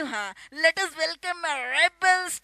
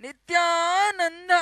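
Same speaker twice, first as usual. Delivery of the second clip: ordinary talk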